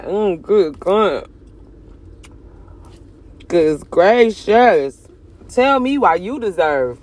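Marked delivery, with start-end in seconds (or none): 0.0-5.1 savoring moan. Real, not acted. Savoring song feelings.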